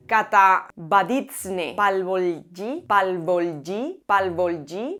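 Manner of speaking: "Katabaditsne Palvolgi" sounded out very slowly, syllable by syllable, then "Palvolgi" tried again; "Palvolgi" tested over and over with a rising, unsure end; careful foreign name. Shown as a demonstration. Host struggling to pronounce a Hungarian researcher's name, hesitant and slow. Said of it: "lo he pronunciado como he podido".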